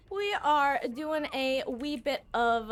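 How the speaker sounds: lightly hammed-up twee phrasing; silly put-on voice. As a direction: say it in a playful put-on voice. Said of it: "I don't know why I said it like that".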